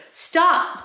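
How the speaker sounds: high, loud desperate cry; shout. A narrator's reading. Woman shouting as her sister self-destructs, desperate shout. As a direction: shout to halt a disaster. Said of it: "Blue shouted".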